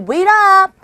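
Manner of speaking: high, loud call to a friend walking off. Shown as a demonstration. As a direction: shout it after a friend who is leaving. Repeats the call.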